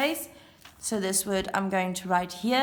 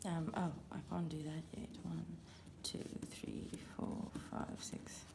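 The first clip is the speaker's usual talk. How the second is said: very soft murmur; barely audible private muttering; talking to oneself; 3 semitones lower, 18 dB quieter than usual. Real, not acted. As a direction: count under your breath. Counting to self while playing.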